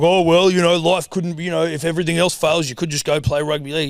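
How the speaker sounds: mock him as a condescending union fan; a hint of a smug stranger taunting a league fan; smug sneer. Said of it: "and he's going like".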